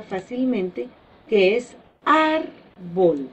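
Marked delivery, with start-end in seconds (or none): 1.3-3.3 "árbol" said slowly, leaning hard on ÁR; stressed syllable shown. Shown as a demonstration. Teacher slowly showing the stressed syllable of "árbol". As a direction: stretch "árbol", pressing the first syllable ÁR. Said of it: "al dividirla en sílabas".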